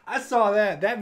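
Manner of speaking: loud words through a laugh. Real, not acted. Fan recalling the scene to viewers, laughing loudly. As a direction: say it still laughing.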